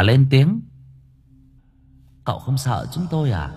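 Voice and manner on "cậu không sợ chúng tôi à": soft ghost woman voice. A narrator's reading.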